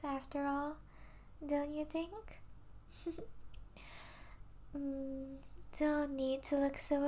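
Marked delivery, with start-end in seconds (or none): 3.0-4.7 quiet, breath-only laugh; soft chuckle. Acted. Vampire amused at own perks, soft chuckle. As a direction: chuckle under your breath.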